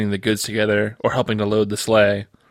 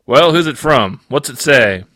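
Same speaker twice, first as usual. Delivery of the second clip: lightly voiced gruff supervisor, louder; sleepy supervisor elf; 4 semitones higher, 5 dB louder than usual. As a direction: perk up from a nap and ask loudly. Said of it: "and asked".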